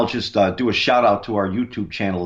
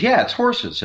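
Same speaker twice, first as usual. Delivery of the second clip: imitating an excited reply in a much higher voice; Lainey; 13 semitones higher than usual. Investigator quoting partner's reply about the sound, high-pitched imitation. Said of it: "And she goes".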